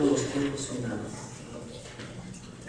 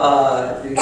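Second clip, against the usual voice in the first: ordinary delivery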